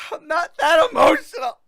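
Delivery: loud, high, tearful mock sob; acted crying. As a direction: ham up a teary protest. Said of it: "now I'm actually making tears 'cause I got into character".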